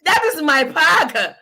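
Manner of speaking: high, loud, slowed-down mock outrage; indignant protest. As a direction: act offended over a snack. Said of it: "I'm like".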